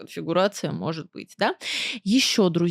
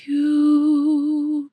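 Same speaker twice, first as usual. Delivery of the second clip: breathy subtone; 6 semitones higher than usual. Shown as a demonstration. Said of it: "опять насубтонила".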